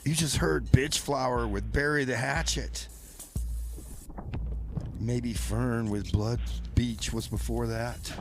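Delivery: ordinary talk, nothing marked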